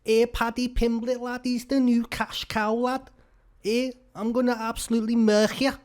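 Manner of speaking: high, hammed-up Scouse accent; mock Liverpool fighter trash talk in a thick Scouse accent; Scouse Paddy Pimblett voice. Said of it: "that's the Scouse accent".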